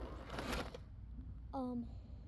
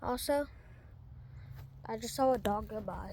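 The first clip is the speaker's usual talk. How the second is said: ordinary talk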